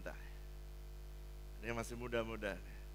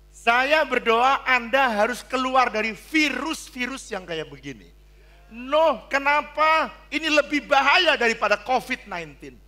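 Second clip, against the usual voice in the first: loud, high warning cry; preaching at full force; 12 semitones higher, 13 dB louder than usual. Acted. Preacher warning against affairs, loud and high. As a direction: pray it over them with force.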